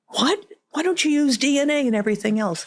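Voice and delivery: high, fast disbelieving cry; shocked and demanding; incredulous outburst. Retold or imitated. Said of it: "grieving families questioning her".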